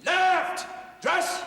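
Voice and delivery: high parade-ground bark; shouted military command; drill shout. Acted. Sergeant orders the squad through rifle drill, shouting. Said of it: "all this shouting all the time".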